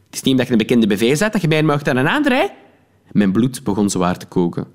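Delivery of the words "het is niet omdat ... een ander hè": fast, a touch higher lecturing voice; mild scolding. Acted. Narrator lectures the snobbish woman, lightly scolding. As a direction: rattle off the reproach.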